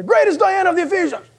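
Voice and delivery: high-pitched crowd chant cry; mock mob yell. Preacher imitates people gathered in protest, mock yelling. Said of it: "they're all yelling".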